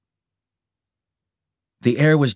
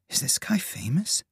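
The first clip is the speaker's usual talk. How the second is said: soft, higher-pitched wondering; thought to herself; 6 semitones higher, 7 dB quieter than usual. A narrator's reading. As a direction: wonder quietly to yourself. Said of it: "she thought".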